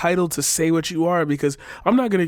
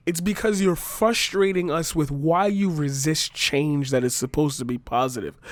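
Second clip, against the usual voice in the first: ordinary delivery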